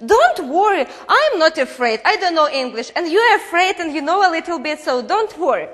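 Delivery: husband shrugs off his wife's worry, breezy; confident reassurance. Retold or imitated. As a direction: brush off her worry with cocky cheer. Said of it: "And he said".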